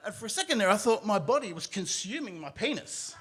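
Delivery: ordinary talk, nothing marked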